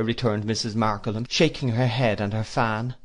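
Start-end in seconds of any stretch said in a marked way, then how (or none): none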